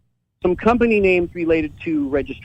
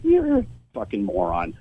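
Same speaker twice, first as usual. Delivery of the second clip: muttered insult; 9 semitones lower, 7 dB quieter than usual. Retold or imitated. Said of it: "It's like".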